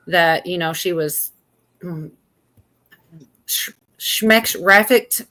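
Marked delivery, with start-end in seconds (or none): 3.4-5.2 schmeck-rafficked slurred slowly, like marbles in mouth. Shown as a demonstration. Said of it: "Gotta say it like there's marbles in your mouth".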